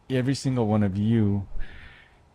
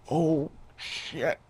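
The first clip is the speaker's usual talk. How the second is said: caught off guard; 6 semitones higher, 5 dB quieter than usual. Retold or imitated. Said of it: "and I'm like".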